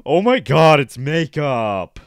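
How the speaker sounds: loud, drawn-out mock wail; mock crying over makeup. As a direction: wail slowly in exaggerated imitation. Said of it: "it's like".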